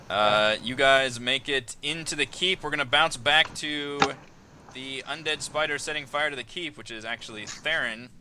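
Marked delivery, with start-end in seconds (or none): none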